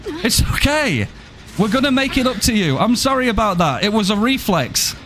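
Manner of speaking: high, apologetic knight voice with sorry my lady; high courtly knight. Pretend. Apologizing to teammate Sable after hitting her, high courtly voice.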